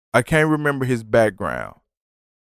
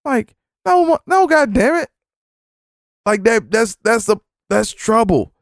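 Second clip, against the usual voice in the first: indignant outburst; 11 semitones higher than usual. Real, not acted. Disgust at coworker's comment.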